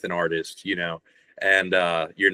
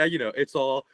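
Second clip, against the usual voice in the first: normal talking voice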